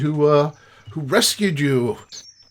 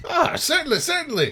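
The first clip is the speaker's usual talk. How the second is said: eager host voice; 12 semitones higher, faster than usual. Acted. GM voices rescuer.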